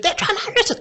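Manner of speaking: high, breathy falsetto quote of the sister; lightly voiced high panicked girl; imitating sister. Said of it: "She said".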